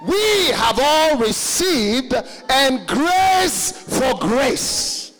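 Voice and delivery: drawn-out, loud preached verse; slow scripture proclamation. Acted. Preacher proclaiming scripture to the congregation, slow and loud. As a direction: declaim the verse slowly, with force.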